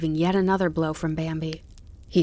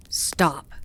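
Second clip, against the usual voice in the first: ordinary delivery, nothing marked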